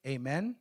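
"Amen" with a rising question at the end. Real, not acted. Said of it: "I said it like a question".

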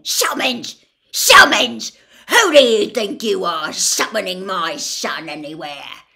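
loud outraged repetition. A narrator's reading. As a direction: explode in disbelieving fury. Gaunt outraged at summons.

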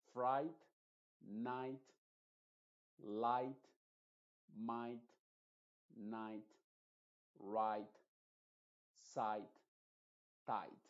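"fright", "night", "light", "right", "sight", "tight" with the "ai" sound and a final T, GH never voiced; silent GH. Shown as a demonstration. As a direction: drill the IGH words one at a time, GH silent. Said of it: "Estoy pronunciando la T simplemente al final". (lista de palabras con "ai" y T final, sin sonar la G ni la H)

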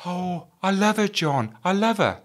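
high, slow, mock pleading voice; pleading lovesick boss. Boss pleading to the officer, high mock whine.

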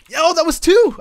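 sudden loud, high yell of delight; real gamer hype yell; excited shout. Real, not acted. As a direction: shout it out, thrilled by the lucky kill. Two golems killed at once.

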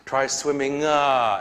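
ordinary delivery

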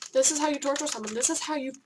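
fast, loud, heated rant; angry rant. Real, not acted. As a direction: hammer the words in frustration. Ranting about torture.

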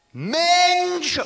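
screamed high and loud; shouted rude word, high and loud. Retold or imitated. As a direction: scream the word in her face. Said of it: "really loudly".

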